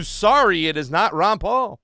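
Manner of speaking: normal talking voice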